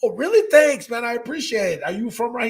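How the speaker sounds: high-pitched, re-enacted grateful surprise; delighted thanks. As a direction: thank a fan with bubbly, lifted pitch. Acting his delighted thanks to fan.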